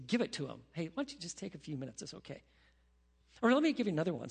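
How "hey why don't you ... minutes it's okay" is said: soft, gentle offer to the spouse; gracious. Retold or imitated. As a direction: say it quietly and kindly, giving space. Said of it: "you graciously say".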